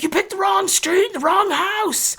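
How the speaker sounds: high shouted warning; high defiant yell. Retold or imitated. Wife warning the creepy stranger he chose the wrong house. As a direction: tell him off, high and sharp.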